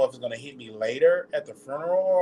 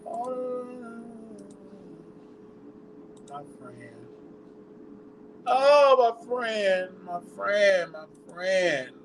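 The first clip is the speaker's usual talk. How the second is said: high, keening cries of grief; real grief breaking through, pitched high; mourning wail, voice lifted high; grieving wail; 7 semitones higher, slower than usual. Real, not acted. Grieving the death of a friend.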